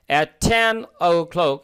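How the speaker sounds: "at ten o'clock" read slowly, each word clear; careful model pronunciation. Shown as a demonstration. Teacher shows students the English e, said slowly and clearly. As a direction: say "ten" with a clear short e, not a Bangla sound. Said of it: "উচ্চারণগুলো খেয়াল করুন".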